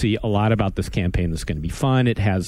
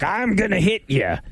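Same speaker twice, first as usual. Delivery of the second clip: gruff dwarf voice; 10 semitones higher than usual. Retold or imitated. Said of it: "he goes, like".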